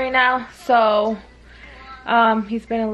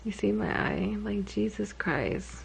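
soft, drained voice; worn out; 13 dB quieter than usual. Real, not acted. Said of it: "I'm pretty shot right now".

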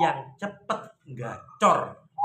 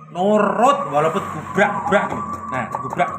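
coaxing the bird; 5 dB louder than usual. Real, not acted. Calling to his bird.

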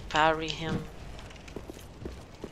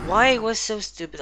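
higher, louder exasperated complaint; real frustration; 6 semitones higher, 8 dB louder than usual. Real, not acted. Player complaining after a stupid setback, frustrated. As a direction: burst out in annoyance, pitch jumping up.

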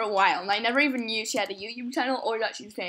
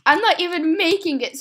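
louder, higher frustrated cry; embarrassed outburst; 5 semitones higher, 7 dB louder than usual. Real, not acted. Player admits she's failing after calling herself pro, louder. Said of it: "I really said that I'm a pro".

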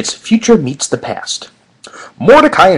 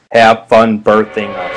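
teasing low voice; 4 semitones lower, 6 dB louder, slower than usual. Acted. [name] teasing.

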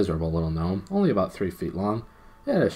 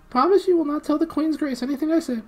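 very high, pleading little voice; whimpering gnome; 6 dB louder than usual. A narrator's reading. Gnome begs his captors, whimpering. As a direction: whimper and beg in a squeaky pitch. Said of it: "whimpered the gnome".

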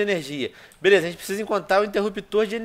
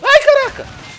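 startled shriek; 11 dB louder, slower than usual. Real, not acted. Sudden zombie scare.

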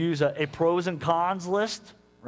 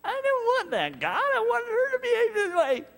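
very high, laughing whine; much higher laughing mock whine; whiny protest. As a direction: squeal like a spoiled child. Mock whining reply to God.

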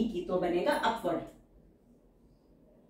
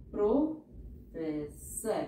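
Professor stretched very slowly; slow teacher dictation; 2 semitones lower, 5 dB quieter, much slower than usual. Shown as a demonstration. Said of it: "teacher says word then dictates".

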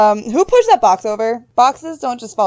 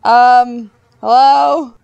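raised, louder hello; calling out; 6 dB louder, much slower than usual. Real, not acted. Player calling up the moving rope, calling out. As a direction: call up for an answer.

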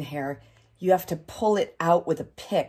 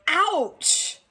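yelled; 10 semitones higher than usual. Acted. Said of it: "Daddy yelled".

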